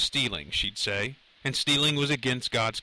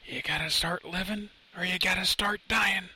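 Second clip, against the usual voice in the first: strained tough-guy challenge, pitched up; Clint Eastwood; 7 semitones higher than usual. A narrator's reading. Tough hero in Lenny's head, restrained higher-pitched goading. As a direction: start restrained, build on the threat.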